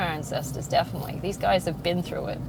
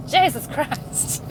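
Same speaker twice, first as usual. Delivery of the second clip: slow, higher Irish exclamation; Irish accent; 6 semitones higher, much slower than usual. Shown as a demonstration. Showing how they swear at work, slow Irish accent. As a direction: let a drawn-out Irish accent come out. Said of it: "That's my Irish coming out".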